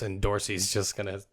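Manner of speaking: ordinary talk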